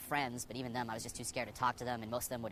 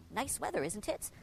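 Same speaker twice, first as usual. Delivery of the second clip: much higher, timid take on "Nice weather, isn't it?"; nervous small talk; 9 semitones higher, slower than usual. Retold or imitated. Shy young man forcing small talk at the newsstand, nervously high. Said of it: "just me saying".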